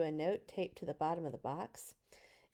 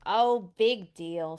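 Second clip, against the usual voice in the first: bored; 7 dB louder, slower than usual. Acted. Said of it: "who gave a bored yawn".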